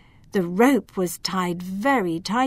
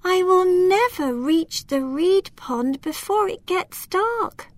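much higher, anxious little voice; worried elf; 11 semitones higher than usual. Acted. Lila fearing the dark before the pond, high and anxious. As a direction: fret as the sun sets. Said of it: "said Lila".

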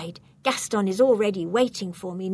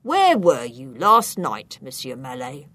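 interrogating policeman; 8 semitones lower, 5 dB louder than usual. A narrator's reading. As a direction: press the suspect like an officer.

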